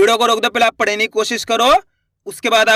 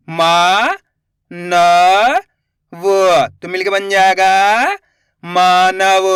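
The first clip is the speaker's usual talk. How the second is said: each sound called out slowly and a bit louder, then the whole word; slow syllable drill; 3 dB louder, much slower than usual. Shown as a demonstration. Teacher sounding out letters for children, slow and clear.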